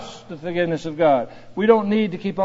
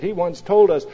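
normal talking voice

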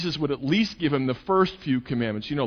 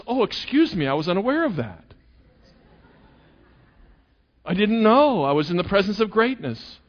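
high, loud, hammed-up sarcastic apology; mock sarcastic; 6 semitones higher, 3 dB louder than usual. Jesus answering the boastful young man, sarcastic. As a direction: pretend to be dazzled by his greatness.

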